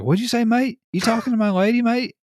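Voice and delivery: jealous fiance snapping at the stranger, high and loud Australian; angry Australian. Retold or imitated. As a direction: bristle like a jealous Aussie bloke. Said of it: "he was like".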